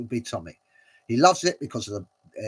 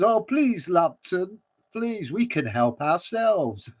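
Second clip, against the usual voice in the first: higher, slow, wheedling creature plea; pleading spider voice; 7 semitones higher, slower than usual. Retold or imitated. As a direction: beg like a cornered little spider.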